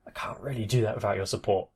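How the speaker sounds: soft breathy whisper. Real, not acted. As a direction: drop to a whisper for the plea. Outro plea for support.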